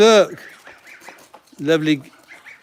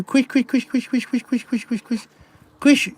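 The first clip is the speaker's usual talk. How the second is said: calling the kitten; 8 semitones higher than usual. Real, not acted. Farmer calls kitten Quish, high and fading. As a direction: coax the kitten over with a high repeated call.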